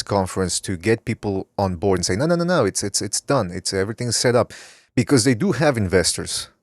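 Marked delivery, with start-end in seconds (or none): none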